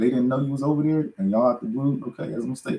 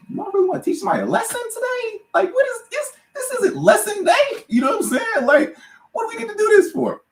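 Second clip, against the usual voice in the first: much higher, light mocking inner voice; inner voice of reason. Arguing with himself on the plane, high mocking voice. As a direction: play his sensible side talking him down. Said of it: "my case was".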